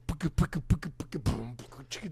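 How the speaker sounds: spitting out drum sounds; beatbox the drummer's part playfully; mock drum beatbox. Imitating the drummer Trevor for a friend, light beatbox. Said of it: "And Trevor's just like".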